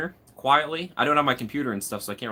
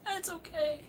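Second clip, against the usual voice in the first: put-on old man voice; 10 dB quieter, slower than usual. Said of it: "I'm becoming such an old man".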